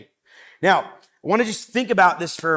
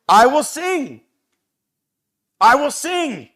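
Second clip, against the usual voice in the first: much higher and louder, soaring; exultant proclamation; 6 semitones higher, 9 dB louder than usual. Acted. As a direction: burst out in joyful praise. Exultant reading of psalm.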